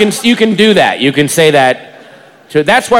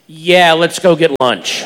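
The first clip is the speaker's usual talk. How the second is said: slow, unimpressed going-along; knowing reluctant agreement; slower than usual. Retold or imitated. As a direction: stretch it out with weary innuendo. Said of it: "But she said it like this".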